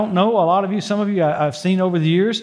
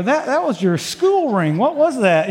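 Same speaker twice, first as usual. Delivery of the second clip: mock indignant; 6 semitones higher, slower than usual. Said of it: "I go".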